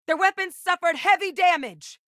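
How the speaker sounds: high, loud battle report; combat shout. Acted. Reporting enemy damage to the crew, excited combat shout. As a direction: call it out over a fight.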